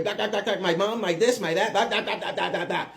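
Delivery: mocking run-on blah-blah chatter; nonstop chattering neighbor. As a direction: imitate a neighbor who won't stop yammering. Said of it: "The lady's still talking".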